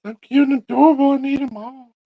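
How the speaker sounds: high gushing voice; high excited kitten gush. Acted. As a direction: squeal over how cute they are. Gushing over cute kittens.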